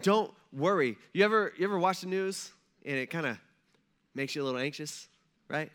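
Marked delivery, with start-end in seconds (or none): none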